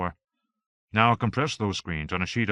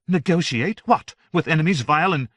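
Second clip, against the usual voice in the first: high, loud indignant outburst; offended at the very idea; bridling; 7 semitones higher, 6 dB louder than usual. A narrator's reading. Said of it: "Joan bridled".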